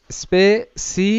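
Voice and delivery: স্পেসিফিকেশন slowly broken into spe, si, fi, ke, shan; syllable by syllable. Shown as a demonstration. Teacher models স্পেসিফিকেশন for students to repeat, slowly syllable by syllable. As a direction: draw out each syllable of স্পেসিফিকেশন with gaps between. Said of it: "এরকম করে তোমরা আমার সাথে সাথে উচ্চারণ করো".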